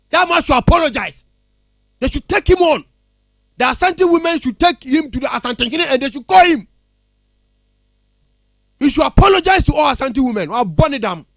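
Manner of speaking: angry demand. Real, not acted. Caller demands apology for Asante women, angry. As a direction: demand it forcefully, voice up and loud.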